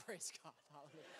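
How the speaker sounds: fast, soft relieved praise; mock relieved praise. Pastor reacting to the boy asking for twenty dollars, soft and fast. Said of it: "And I'm like".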